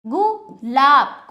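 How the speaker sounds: Gulab slow and loud, split into गु and लाब; drawn-out two-part gulab; broken-up pronunciation. Shown as a demonstration. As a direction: pause after गु, stretch लाब. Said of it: "तोड़कर जोड़कर उच्चारण".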